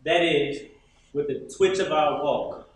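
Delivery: normal talking voice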